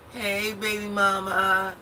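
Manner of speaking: high, slow smooth-talker greeting; imitating her slick ex. Ex greeting his baby mama outside at one in the morning, mock slick. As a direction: stretch it out like a man who thinks he's slick. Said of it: "This is what he say".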